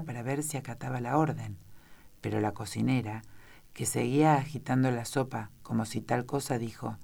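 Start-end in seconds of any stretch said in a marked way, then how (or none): none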